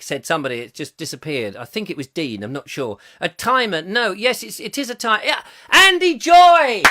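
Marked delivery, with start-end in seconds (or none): 5.7-6.8 loud, drawn-out game show call; big triumphant announcement; winner announcement shout. Real, not acted. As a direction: call out the winner's name like a host.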